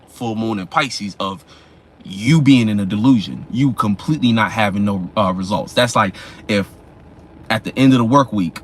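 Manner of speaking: normal talking voice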